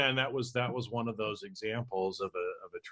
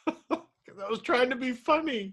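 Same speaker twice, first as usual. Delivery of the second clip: laughing. Real, not acted. Co-host reacting to crack story, laughing through words. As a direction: talk through real laughter, high and slow.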